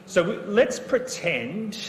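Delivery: high, slow, sing-song pretend voice; kindergarten teacher voice. Shown as a demonstration. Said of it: "sound like a kindergarten teacher".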